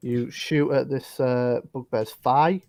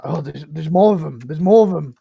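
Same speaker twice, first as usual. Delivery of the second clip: lightly voiced startled bugbear, louder on each "more"; mild alarm; 2 semitones higher, 7 dB louder than usual. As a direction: sound the alarm with some urgency. Voicing startled bugbears at the door.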